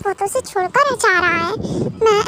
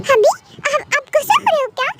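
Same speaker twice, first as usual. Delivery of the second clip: higher-pitched, anxious little question; worried, childlike; 5 semitones higher, faster than usual. Acted. Kookie asks hubby if he is angry, worried. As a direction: ask timidly in a raised, babyish voice.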